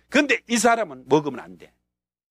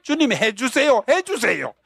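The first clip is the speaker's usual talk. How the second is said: urgent prayer; 7 semitones higher, slower than usual. Retold or imitated. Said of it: "다시 한번".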